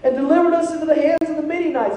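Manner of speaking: imitated whiny protest; mock whining. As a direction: pile up the grievance in a high mock whine. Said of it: "he's just whining".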